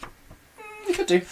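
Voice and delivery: usual speaking voice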